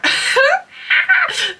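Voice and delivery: high-pitched burst of laughter; high, helpless giggle; embarrassed laugh. Real, not acted. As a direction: crack up at your own bad accent. Cracks up at own French accent.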